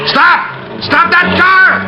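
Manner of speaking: detective shouting at the getaway car. Acted. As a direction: bellow it across the street. Chasing fleeing car.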